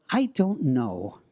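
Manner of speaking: ordinary delivery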